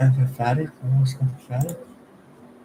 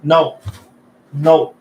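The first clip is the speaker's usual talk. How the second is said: firm loud no; 3 semitones higher, 8 dB louder than usual. Real, not acted. Answers voice assistant's wrong guess.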